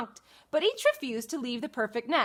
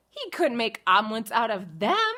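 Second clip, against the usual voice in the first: ordinary delivery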